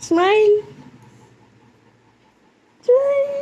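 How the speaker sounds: very high-pitched coo. Real, not acted. Mother asking baby to smile, high coaxing baby talk.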